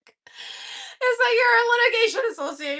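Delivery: high, squeaky from laughing; cracking up mid-sentence; laughing through words. Real, not acted. Cracking up telling own story.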